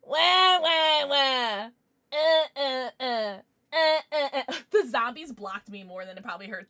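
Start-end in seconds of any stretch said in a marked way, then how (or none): 0.0-1.7 high, loud mocking wah wah; mock crying. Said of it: "It's like". 2.1-4.5 high, loud mocking uh uh; mock whimper. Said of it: "It's like".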